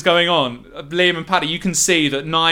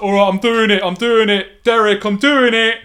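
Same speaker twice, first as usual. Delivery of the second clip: high, wary London thug, loud; nervous thug caught at knifepoint; collared ruffian pleading with the captor; 6 semitones higher, 6 dB louder than usual. Acted. Nige complies nervously.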